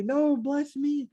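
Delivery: put-on high, slow, whining plea like a spoiled kid; whiny begging son. Esau whining at his father for the blessing, high and slow. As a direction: stretch it out, a sulky child begging.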